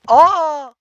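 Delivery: sudden loud high gasp of surprise; startled cry. Real, not acted. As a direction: caught off guard by the game.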